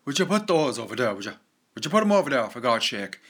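put-on exasperated Kerry accent, loud and singsong. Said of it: "West Cork and kind of into Kerry".

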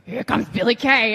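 loud, rough mock announcer call; aggressive dude's voice. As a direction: imitate your own hoarse, mannish commentary shout. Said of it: "like, a dude's voice right now".

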